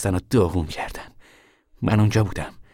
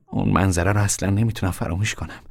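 ordinary delivery, nothing marked

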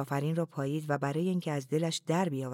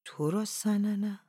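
slow, drawn-out dry delivery, pitched higher; dry, husky voice; 5 semitones higher, slower than usual. A narrator's reading. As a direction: say it dry and husky, dragging it slightly.

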